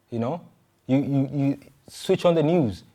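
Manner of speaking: ordinary talk, nothing marked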